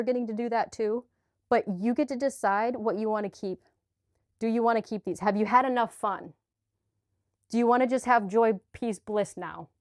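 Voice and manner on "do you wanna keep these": normal talking voice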